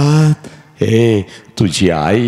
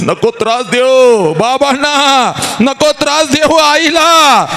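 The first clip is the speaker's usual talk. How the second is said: 1 crying plea; 4 dB louder than usual. Acted. Bride begs brother crying.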